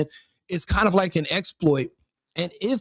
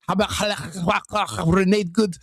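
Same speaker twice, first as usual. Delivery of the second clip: slow put-on Somali accent; quick mock Somali accent; slower than usual. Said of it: "that was my best Somali accent".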